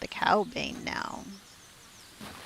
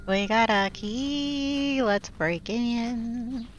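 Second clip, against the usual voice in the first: long drawn-out, raised half-sung cheer; gleeful sing-song; 3 semitones higher, 3 dB louder, much slower than usual. Real, not acted. Player cheering on finding the key, gleeful sing-song.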